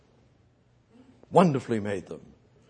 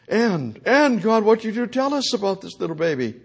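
normal talking voice

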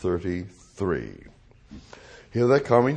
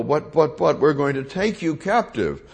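soldiers quoted; 6 semitones higher, 5 dB louder than usual. Retold or imitated.

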